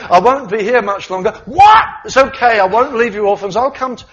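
loud high frantic yelling; panicked shout. Pretend. Disciples reacting to Jesus' departure, panicked shouting. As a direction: shout over each other in alarm.

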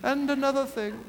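high-pitched mock royal voice; the Queen. Preacher imagining the end of a royal speech, regal. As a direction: put on a regal, elevated tone. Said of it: "this is my Queen impression".

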